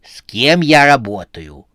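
loud, drawn-out exasperation; outraged, slow rhetorical question; indignant. A narrator's reading. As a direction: lament the useless staff at full voice. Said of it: "возмутилась Сюзанна".

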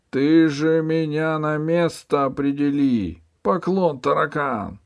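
high, slow pleading; begging. Acted. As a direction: beg for mercy. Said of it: "взмолился Синий Нос". (высоко, протяжно, с мольбой)

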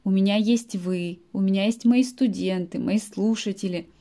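usual speaking voice

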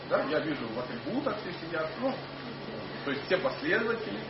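usual speaking voice

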